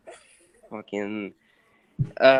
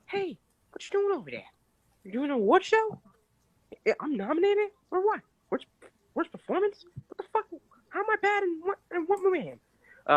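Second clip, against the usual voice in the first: much higher indignant put-on voice; mock Mark Wahlberg. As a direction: play a baffled, outraged star. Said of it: "Mark Wahlberg in Milo twenty-two".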